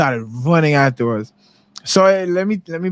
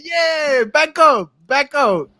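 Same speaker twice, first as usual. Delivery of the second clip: high shouted taunt; mock shout; 9 semitones higher, much slower than usual. Boy taunts the chaser behind gang backup, shouting. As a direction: yell at them to back up.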